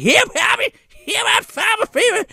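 high, hammed-up spluttering cry; comic high-pitched near-sneeze voice; spluttering grandpa. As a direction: play an old man caught by pepper.

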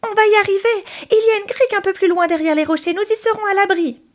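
put-on high, fast boy's voice pushing on; urgent puppet boy. Pinocchio tells his father where to swim, fast urgent. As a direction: rush the words out, hopeful.

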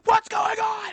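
high mock shriek; over-the-top imitation scream; Keemstar scream. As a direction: yell it suddenly, way up high. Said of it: "he'll just, like, scream".

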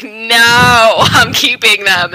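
loud retelling. Retold or imitated. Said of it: "Franklin was like".

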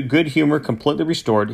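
usual speaking voice

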